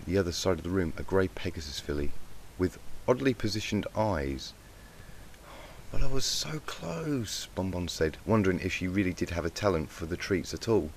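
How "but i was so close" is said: higher, slow letdown; disappointed. Acted. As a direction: say it slowly, let down. Pupil loses the contest.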